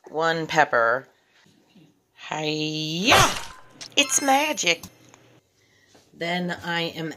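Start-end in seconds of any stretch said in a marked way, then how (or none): 2.3-3.3 drawn-out chop cry ending loud; playful hammed-up martial arts yell; mock karate cry. Cook pretending to karate-chop one pepper, mock battle cry. 3.9-4.8 high, loud mock amazement; playful magician's reveal. Cook showing viewers the diced pepper, playful high reveal.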